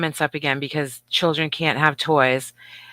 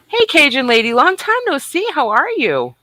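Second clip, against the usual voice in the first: excited greeting; 9 semitones higher, 5 dB louder than usual. Real, not acted. Greeting a long-missed chatter.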